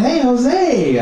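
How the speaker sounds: high-pitched, hearty hello; calling out a greeting. Acted. Comic greeting a work friend he teases, calling out. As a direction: call to a buddy, pitch lifted.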